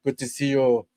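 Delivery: put-on French accent. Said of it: "I would love to have a French accent".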